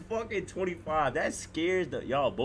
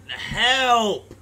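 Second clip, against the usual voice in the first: sudden loud yelp for help; panicked cry for help; 5 semitones higher, 8 dB louder than usual. Real, not acted. Streamer stuck in a game calls out for help. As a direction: shout it out, scared.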